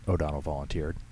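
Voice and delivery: plain reading voice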